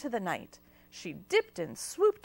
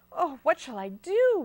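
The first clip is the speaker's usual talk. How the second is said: higher and louder, torn; agonized; 6 semitones higher, 4 dB louder than usual. A narrator's reading. Watilda torn between the kitten and the job, anxious fretting. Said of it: "Watilda agonized".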